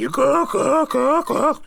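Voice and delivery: slow, high mock clucking; chicken clucking. Old man mocking teammates with hen clucks. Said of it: "А ну покукарекай".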